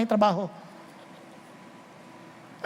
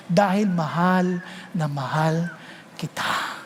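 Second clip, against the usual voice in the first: tender fatherly voice; 9 semitones lower, 3 dB quieter than usual. Acted. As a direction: soften right down, almost breathing the last word. God tenderly speaking to his child.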